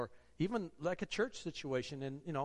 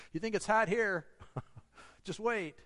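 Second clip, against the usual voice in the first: laughing joke; 5 semitones higher, 3 dB louder than usual. Real, not acted. Joking about hell heat.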